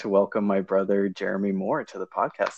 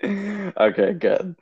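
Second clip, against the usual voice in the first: laughing; 11 semitones higher, much slower than usual. Real, not acted. Laughing at brother's joke.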